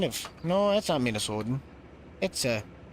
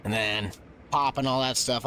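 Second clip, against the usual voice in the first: ordinary delivery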